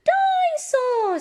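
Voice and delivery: put-on very high, loud and slow toddler squeal; little George voice. Little brother naming his new toy, high slow squeal. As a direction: stretch the name like a thrilled little piglet.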